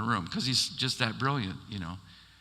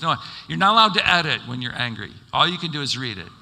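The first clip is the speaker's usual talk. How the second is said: firm insistence; 3 semitones higher, 7 dB louder, faster than usual. Retold or imitated. Pastor's mentor insisting firmly.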